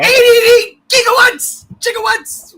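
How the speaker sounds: high, loud, drawn-out exclamation; mock panicked shout. Riffing on a time-travel movie line, mock panicked shout. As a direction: cry it out in over-the-top alarm.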